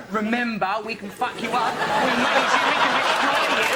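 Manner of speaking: mock threat. Voices Britain's imagined threat to Obama.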